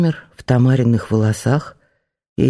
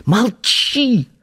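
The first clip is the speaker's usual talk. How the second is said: high, tight hissed hush; strangled hiss; 10 semitones higher than usual. A narrator's reading. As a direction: hiss it to silence her at once. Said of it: "прошипела сдавленным голосом". (сдавленное шипение)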